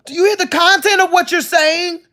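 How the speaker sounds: high, loud, disbelieving challenge; incredulous outburst. Real, not acted. To [name] over his shirt remark, high incredulous cry. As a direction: demand he hear his own words.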